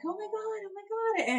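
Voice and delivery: high-pitched mock panic; retold panic. Labouring mother still not numb, acted-out panic. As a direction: replay the panic, voice shooting up. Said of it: "I was having a panic attack".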